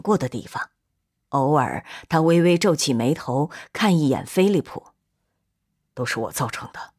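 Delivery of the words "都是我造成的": low, hushed admission of blame; guilty confession. A narrator's reading. Philip takes the blame before Gino, low and guilty. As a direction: confess it softly, pitch dropped right down.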